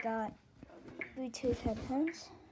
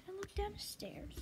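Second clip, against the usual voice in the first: whispering; 8 semitones higher, 7 dB quieter than usual. Real, not acted. Others asleep, early morning.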